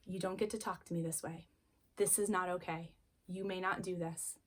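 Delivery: soft firm voice of a calm parent; calm firm limit. Retold or imitated. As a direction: hold the line quietly while the child escalates. Calm limit to escalating child.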